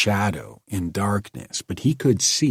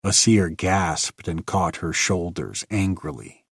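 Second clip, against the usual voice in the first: ordinary delivery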